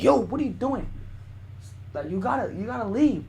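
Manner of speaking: high, indignant retold challenge; mock outraged "back off" tone; acted confrontation.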